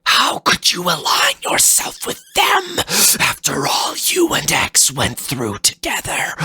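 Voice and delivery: very high, whispery rage; hoarse fury. Acted. Fafnir accuses Zero of betraying X, furious and whispered. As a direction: spit the betrayal out.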